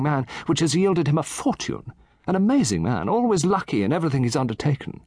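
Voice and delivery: put-on a chatty old gentleman relishing his tale; Satterthwaite. Satterthwaite enthuses.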